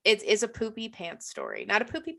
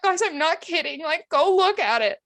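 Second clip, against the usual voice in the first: high-pitched, half-laughing appeal; insistent protest; 6 semitones higher than usual. Retold or imitated. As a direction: plead to be believed in a mimicked high voice. Acting out co-worker insisting, horrified.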